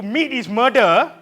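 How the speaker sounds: loud, high, drawn-out protest yell; mock-outraged scream. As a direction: scream it slowly at the customer. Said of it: "they scream at you".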